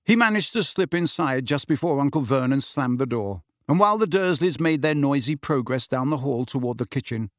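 ordinary delivery, nothing marked

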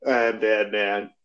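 low, slow gravel of Batman; deep Batman voice. Pretend. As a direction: drop low and slow as the caped hero. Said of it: "That doesn't sound very convincing".

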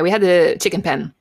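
normal talking voice

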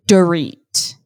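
usual speaking voice

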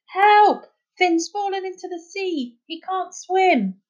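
high, slow desperate call; shouting. Acted. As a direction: shout for help across the water. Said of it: "she shouted".

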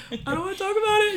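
high, loud, rushed wail; hammed-up crying outburst; mock wailed refusal. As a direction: blurt it out in a tearful squeal. Repeated tearful refusal, bigger.

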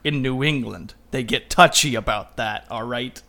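loud gruff Boston bartender voice; hammy Boston tough guy, scolding; Boston accent. Retold or imitated. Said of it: "Boston accent".

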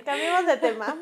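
ordinary talk

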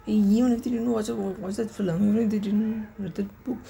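ordinary talk, nothing marked

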